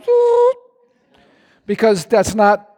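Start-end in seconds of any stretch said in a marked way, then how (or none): 0.0-0.6 high-pitched imitated zip sound; cartoon zoop for hair standing up; high zoop noise. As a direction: zip upward in a high squeak. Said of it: "the hair on the back of your neck just goes".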